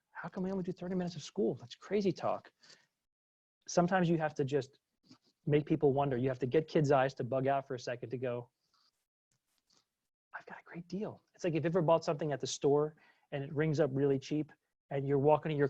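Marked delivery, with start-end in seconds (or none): 0.1-2.5 put-on higher, fast, disbelieving kid voice; incredulous kid. Kids baffled by short school time. 10.4-11.2 conspiratorial whisper over a bargain; sly whisper. Retold or imitated. Kid secretly pleased with bargain.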